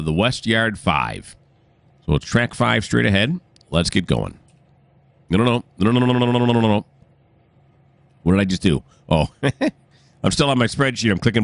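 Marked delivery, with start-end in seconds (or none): none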